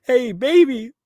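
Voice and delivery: high, loud, hammed-up greeting to a lover; imitating a would-be charmer; Jake sweet-talking. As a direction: lay on a mock sweet-talk. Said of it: "and he's like".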